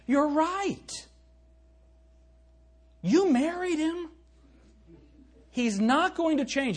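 high mocking voice, a joke at the wives; mock agreement.

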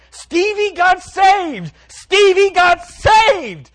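very high, loud, drawn-out shriek; imitating an overjoyed grandmother screaming; screaming grandma. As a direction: scream the happy news to all your friends. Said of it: "running around to her friends screaming".

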